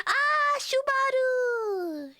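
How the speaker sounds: playful shout. Pretend. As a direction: call the nickname out gleefully. Yelling the nickname.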